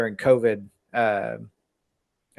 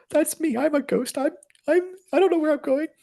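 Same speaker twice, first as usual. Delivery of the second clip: squeaky-high imitation of a lost teenybopper self. Podcaster mimicking his teeny bopper self, in a high voice. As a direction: play your younger self in a very high voice. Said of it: "you're doing it in that high voice too".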